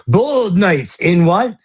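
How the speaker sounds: high-pitched, teasing courtroom prompt; goading. Acted. Lawyer prodding the other side to finish his phrase, goading and high. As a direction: lead them on, pretending not to hear.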